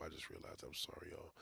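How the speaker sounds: sudden unvoiced whisper to the audience; hushed confession aside. Real, not acted. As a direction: drop to a whisper, apologizing. Aside apology, dropped voice.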